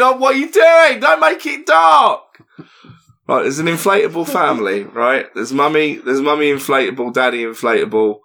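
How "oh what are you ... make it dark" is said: high shouted protest, dragging the last words; mock outraged shout. Real, not acted. Shouting at the co-host not to make the joke dark. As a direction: yell at him to stop making it grim.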